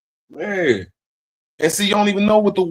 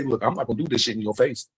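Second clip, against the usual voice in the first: dropped low and soft, muttered aside; imitating quiet aside; 8 semitones lower, 7 dB quieter than usual. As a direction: say it low under your breath. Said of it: "I always say".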